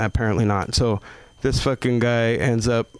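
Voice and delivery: ordinary delivery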